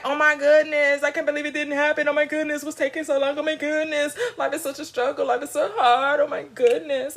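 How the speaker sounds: high, steady run of whiny worry; mock despairing complaint. Speaker showing listeners how not to talk to themselves, mock despairing complaint. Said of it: "Don't be like".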